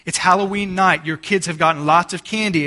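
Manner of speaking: usual speaking voice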